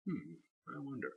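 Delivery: a soft, private hum of wonder; quiet musing. Real, not acted. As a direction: trail off thinking aloud. Musing aloud about next week.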